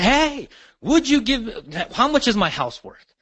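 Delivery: mock seller, lifted high and slowed; a seller on the phone asking what the house is worth, high and slow; curious seller voice. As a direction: play the homeowner asking on the phone, lightly. Quoting a seller's phone question.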